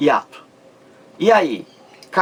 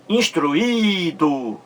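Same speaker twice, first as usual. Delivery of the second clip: instruído slow and higher, with a long í; stretched stressed i; 4 semitones higher, much slower than usual. Shown as a demonstration. Teacher showing the I sozinho, instruído with drawn-out í. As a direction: hold the í of instruído before a short do. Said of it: "O I está sozinho na sílaba".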